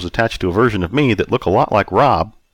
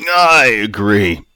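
put-on slow, louder drunkard drawl; drunk Bob; 3 semitones higher, 5 dB louder, much slower than usual. A narrator's reading. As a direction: drag the words out drunkenly. Drunk Bob drags out agreement.

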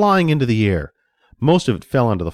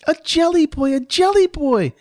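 put-on very high child's squeal; high-pitched, overjoyed kid voice; joyful squeal; 3 dB louder than usual. A narrator's reading. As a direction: squeal with delight like a little boy. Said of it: "Johnny squealed in joy".

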